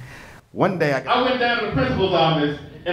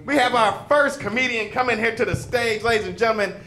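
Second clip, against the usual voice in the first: raised, hype host patter; emcee intro; 5 semitones higher than usual. Acted. Host to the audience introducing a comedian, hype announcer. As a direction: hype up the crowd for the next act.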